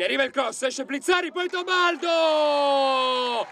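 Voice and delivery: high shouted goal call, stretched out; breathless italian goal commentary. Real, not acted. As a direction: drag out the name and scream goal. Second equaliser goal call. (urlo del gol, allungato e acuto)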